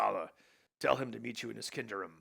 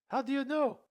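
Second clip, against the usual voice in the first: Elsa voice; 6 dB louder than usual. Acted.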